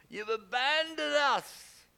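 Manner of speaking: slow, high, loud wailing cry; anguished plea. Acted. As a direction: moan it out like a forsaken people.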